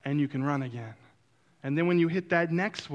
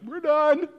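high, raised voice of a protesting body; body giving up; 10 semitones higher, 4 dB louder than usual. Acted. Runner's body quitting a mile ahead, high-pitched protest. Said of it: "my body goes".

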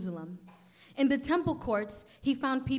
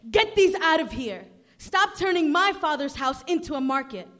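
loud, higher, forceful reading; stern command; 4 semitones higher, 8 dB louder than usual. A narrator's reading. Jesus ordering sellers out of the temple, angry. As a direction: order them out with authority.

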